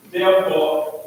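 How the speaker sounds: ordinary talk, nothing marked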